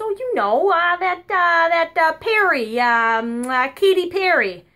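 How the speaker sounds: high, loud groping for the name; old lady searching for a word; grandma fumbling. Retold or imitated. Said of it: "She goes".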